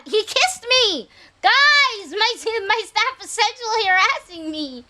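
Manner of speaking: squealing fake indignation, laughing; mock outrage. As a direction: ham up being scandalised. Mock outrage at game character.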